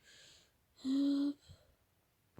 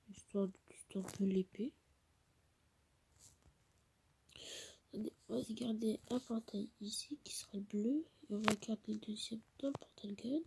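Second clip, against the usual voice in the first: whispering; 5 dB quieter than usual. Real, not acted. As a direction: hush it, almost no voice. Whispering, mother downstairs. (chuchotement discret)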